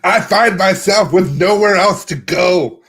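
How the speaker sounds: mock anguished yell, loud then easing off; yelled inner struggle. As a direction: cry it out to the whole group. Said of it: "yell their inner struggles".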